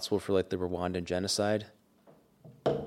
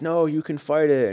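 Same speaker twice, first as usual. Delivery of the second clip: pleading John Connor; 9 semitones higher, 8 dB louder than usual. Retold or imitated. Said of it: "John Connor's like".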